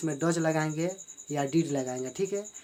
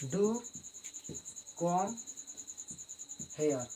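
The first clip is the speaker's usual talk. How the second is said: "Do", "come", "here" spaced out with long pauses; stress on do; 9 dB quieter, much slower than usual. Shown as a demonstration. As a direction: say "Do" first, then each word slowly apart. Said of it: "do लगा देना है". (हर शब्द अलग-अलग, "Do" पर ज़ोर)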